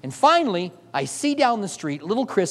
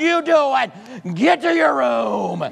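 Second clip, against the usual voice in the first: angry parent; 4 semitones higher, much slower than usual. Retold or imitated. Scared parent scolding son.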